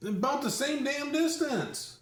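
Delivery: high-pitched, indignant outburst; exasperated protest. Real, not acted. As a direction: fire back in laughing exasperation. Bickering over being heard.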